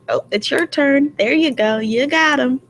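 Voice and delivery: high, egging-on coach voice; mock pimp coaching. Friend coaching another to get guys' car rides, mock encouraging.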